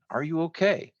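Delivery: high, concerned call; shouted. A narrator's reading. Sam checking on his winded friend, shouted. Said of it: "he shouted again".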